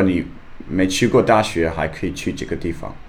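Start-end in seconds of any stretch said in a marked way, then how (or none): none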